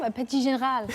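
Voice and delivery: "petit général" with French vowels and uvular r; French accent. Shown as a demonstration. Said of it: "I think I did quite a good job".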